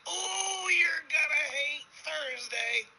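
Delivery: very high, drawn-out holler; hammed-up high convict voice; gleeful punchline. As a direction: stretch the oh, sing out the bad news.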